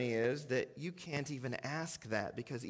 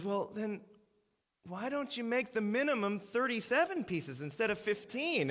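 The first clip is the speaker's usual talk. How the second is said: higher, pointed retort; pointed comeback; 6 semitones higher than usual. Retold or imitated. Waitress challenging the manager, pitched up and pointed. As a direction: turn his logic back on him. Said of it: "she says".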